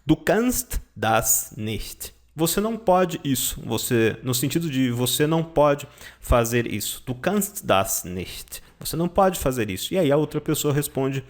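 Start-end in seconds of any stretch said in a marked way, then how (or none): 0.1-2.1 slow, lower German model line; slow, lower teacher demo; slow example sentence. Shown as a demonstration. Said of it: "Outro exemplo de frase". 7.1-8.6 slow, lower German line; slow, lower model line; slow example sentence. Shown as a demonstration. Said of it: "Outro exemplo de frase".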